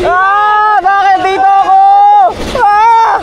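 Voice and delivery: loud panicked ride yells; screaming. Real, not acted. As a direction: scream it over the wind.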